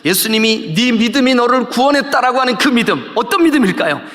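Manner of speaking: high, driving sermon delivery; full-force preaching. Real, not acted. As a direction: build phrase by phrase into a fervent proclamation.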